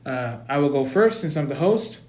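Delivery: ordinary delivery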